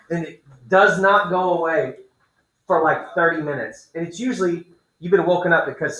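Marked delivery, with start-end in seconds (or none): none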